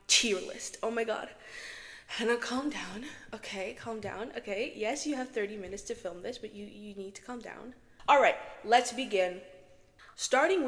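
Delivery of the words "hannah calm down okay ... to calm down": soft pep talk to oneself; self-soothing. Real, not acted. Vlogger telling herself to calm down while filming, self-soothing. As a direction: talk yourself down, voice dropping away.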